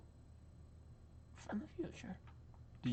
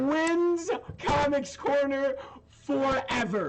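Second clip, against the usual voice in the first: excited; 8 semitones higher, much slower than usual. Real, not acted. Hyped up announcement.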